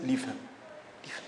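low, soft retold order; calm restraint. Holy man calming his upset followers, low and soft.

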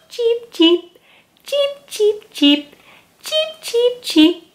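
high squeaky cheeping chick; chick cheeps. Acted. Reading aloud, cheeping like a little chick. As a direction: chirp it like a tiny chick. Chick sounds in picture book.

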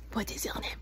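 hushed, confidential whisper. Pretend. Clown doctor asking an animal its name, whispered. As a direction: whisper it as if sharing a secret. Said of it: "You can whisper in my ear".

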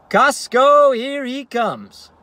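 high loud "Costco", then slow drawn-out "here he comes"; triumphant battle cry. Acted. Dad heading off to Costco, triumphant high declaration. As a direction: announce it like a conquering hero.

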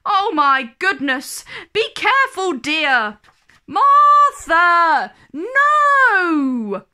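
panicked nanny yelling no; alarmed nanny. A narrator's reading. Nanny shouting at Martha under the dinosaur, alarmed.

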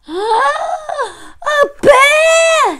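very high, loud shriek of alarm; storybook scream. A narrator's reading. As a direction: scream it like a frightened child.

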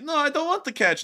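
high, mock-pleading voice; pleading soldier. Hunted soldier begging off a game of catch, high mock pleading. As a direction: beg in a high, put-on voice. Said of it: "he's like".

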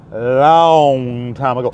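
low, slow storyteller echo; low drawn-out echo; dramatic slow echo. Shown as a demonstration. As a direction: draw it out low like a legend. Repeated dramatically.

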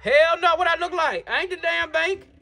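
high-pitched, indignant no; indignant refusal. Acted. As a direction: refuse as if the request is outrageous. Refuses inmate's plea for help.

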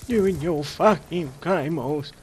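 slow, drawn-out mock Cockney jibe. Gamer mocking a friend's claymores, in mock Cockney. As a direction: lean into a hammed-up London accent, slowly.

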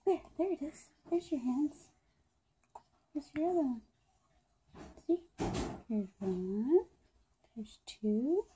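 soft, near whispering. Real, not acted. Talking softly to the baby.

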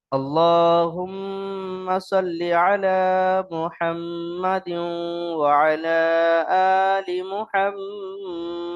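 slow, long-held chanted syllables; joined recitation. Shown as a demonstration. Teacher drilling students on joined reading, slow drawn-out recitation. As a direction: recite it joined, stretching the nasal hum and long vowels. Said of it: "জুড়ে পড়ে মশ করালাম".

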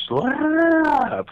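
very high, loud cracking slap noise; sharp vocal slap effect; imitated slap sound. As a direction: mimic the slap with your voice. And I hear.

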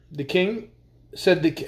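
usual speaking voice

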